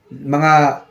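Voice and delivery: ordinary talk, nothing marked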